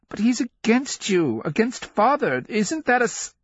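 put-on high, insistent little boy's protest; protesting child. A narrator's reading. Son fighting his mother over his uncle, protesting shrilly high. Boy argues back, is cut off.

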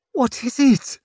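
high, strained, frightened question; horrified question. A narrator's reading. Mother dreading the surgeon's news, horrified. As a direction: ask it in dread through pain. Mother in pain and cold horror.

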